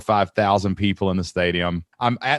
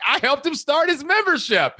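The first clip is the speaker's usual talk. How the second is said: proud reveal. Retold or imitated. Proud reveal to sound guy.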